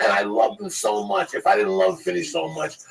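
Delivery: ordinary talk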